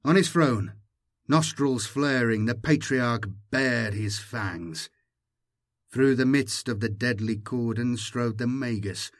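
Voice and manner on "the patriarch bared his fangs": ordinary delivery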